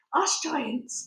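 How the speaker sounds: plain reading voice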